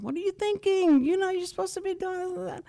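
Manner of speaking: high-pitched mock coach yell; imitating a coach telling players off. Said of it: "yell at the kids".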